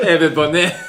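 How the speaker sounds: put-on a French accent while cracking up; mock French accent. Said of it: "French person saying boner".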